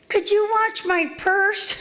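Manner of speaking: high, slow sing-song mockery of a trusting woman; mocking naive woman. Mocking the naive women at the meeting, high and slow. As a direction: parody her sweet request, slowly and way up high. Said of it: "stupid women there going".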